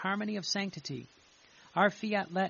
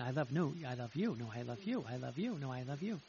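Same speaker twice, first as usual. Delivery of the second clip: playful love competition; 5 semitones lower, 5 dB quieter, faster than usual. Pretend. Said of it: "it's gotta be a competition".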